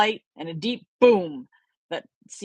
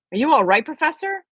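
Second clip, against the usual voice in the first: anxious; 8 semitones higher, 4 dB louder, faster than usual. A narrator's reading. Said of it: "He looked very anxious".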